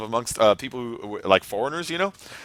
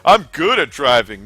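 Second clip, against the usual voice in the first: slow mock wrong phrasing; 7 dB louder than usual. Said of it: "I don't wanna be like".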